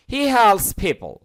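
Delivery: He helps people drawn out very slowly; teacher asks students to notice the pronunciation; slow pronunciation model. Shown as a demonstration. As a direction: say it slowly so every sound is heard. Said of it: "খেয়াল করুন উচ্চারণগুলো".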